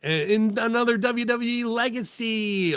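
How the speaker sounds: high, syrupy mock delight; mock gushing. Host mimics company gushing over a wrestling baby, mock gushing. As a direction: coo it in a high put-on voice, mocking their excitement. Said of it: "they act like".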